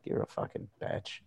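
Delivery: muttered low under the breath; very quiet, nearly voiceless aside; secret insult. Acted. Comic confessing what he privately thinks behind friendliness, muttered secret insult. Acting out hidden contempt.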